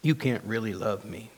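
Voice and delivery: low, soft self-reproach; broken inner voice. Acted. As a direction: confess quietly to God, with long pauses. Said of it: "my inner broken voice tells me".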